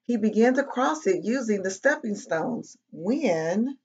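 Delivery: animated read-aloud with bouncy pitch; children's story narration. A narrator's reading. Reading a picture book to kids, lively storytelling. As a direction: tell it to little listeners, pausing before each mishap.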